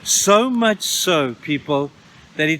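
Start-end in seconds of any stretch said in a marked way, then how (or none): 0.0-1.3 drawn-out emphasis. Real, not acted. Preacher stresses God's delight.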